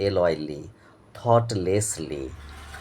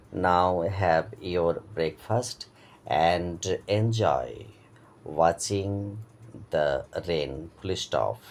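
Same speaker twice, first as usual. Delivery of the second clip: slow reading; 3 semitones lower, 3 dB quieter, much slower than usual. Shown as a demonstration. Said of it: "teacher reads prose aloud".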